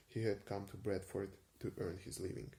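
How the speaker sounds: usual speaking voice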